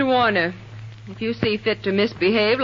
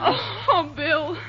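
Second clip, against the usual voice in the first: sobbing; 5 semitones higher, slower than usual. Acted. Weeping over shot sweetheart.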